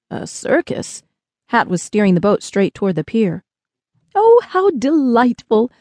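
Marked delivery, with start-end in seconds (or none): 0.1-1.0 softer, higher, wondering; puzzled question. Acted. Spaceman querying the boy's word, puzzled and soft. 4.1-5.7 slow, high, delighted squeal; high-pitched squeal. A narrator's reading. Tall visitor thrilled about a circus, high delighted squeal. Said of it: "squealed Theo in his high-pitched voice".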